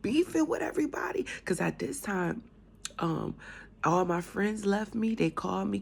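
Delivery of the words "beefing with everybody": tearful plea. Retold or imitated. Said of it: "sitting there crying".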